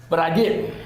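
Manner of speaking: ordinary delivery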